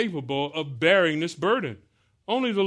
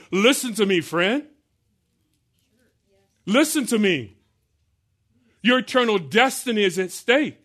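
louder, higher pleading with a friend; urgent witness; 6 semitones higher, 5 dB louder than usual. Retold or imitated. Believer sharing the gospel with a friend, urgent plea. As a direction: grab the friend's attention. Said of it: "you're saying to them".

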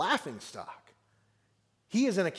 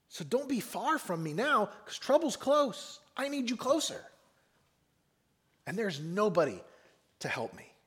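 pleading; 4 semitones higher than usual. Acted. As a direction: beg God in a raised, strained-upward pitch. Voices psalmist's plea to God.

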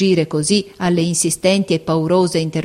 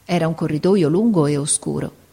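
ordinary delivery, nothing marked

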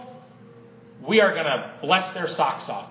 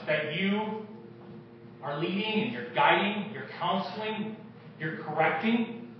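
prayer; 4 dB quieter than usual. Acted. Pastor praying over the congregation, softly. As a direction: pray over the room gently.

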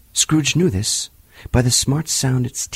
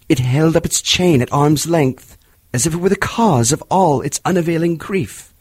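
plain reading voice

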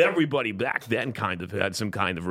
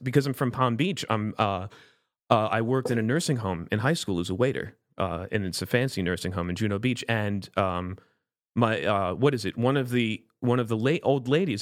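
ordinary talk, nothing marked

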